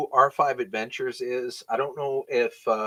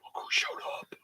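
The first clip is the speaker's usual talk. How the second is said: whisper; 11 dB quieter than usual. Real, not acted. Whispered aside.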